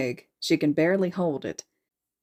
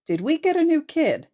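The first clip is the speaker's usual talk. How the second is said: surprised high question; 12 semitones higher, 5 dB louder than usual. Acted.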